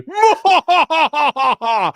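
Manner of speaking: high hammy villain cackle; evil villain laugh. Retold or imitated. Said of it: "given a character along these lines".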